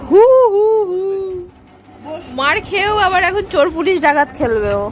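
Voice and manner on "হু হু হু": imitating a child's high, loud boo-hoo sob; hammed-up crying sound; mock crying. Asked how the kid cried, imitates the crying sound. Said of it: "কান্নাটা কেমন ছিল অগি?".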